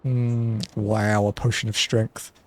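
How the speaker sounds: ordinary talk, nothing marked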